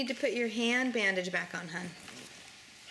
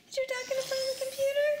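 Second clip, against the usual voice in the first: quick singsong question pitched way up; high, fast baby talk; 11 semitones higher, much faster than usual. Acted. Mother teases her crawling baby in high fast baby talk.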